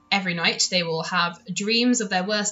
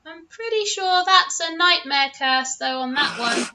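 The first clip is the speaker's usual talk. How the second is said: little dragon voice; 7 semitones higher than usual. Acted. Dragon peers at armour.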